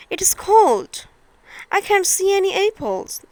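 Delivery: put-on a touch of a chilly, worried small girl; Thumbelina's voice. Thumbelina searching for apples in the cold, in a light girlish voice.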